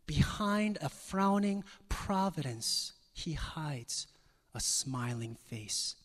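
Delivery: slow, emphatic recitation with long pauses; slow poem reading. Shown as a demonstration. Preacher reading Cowper's hymn to his congregation, slow and declamatory. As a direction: read it slowly, weighting each phrase, swelling and easing. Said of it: "I'm gonna read it slowly".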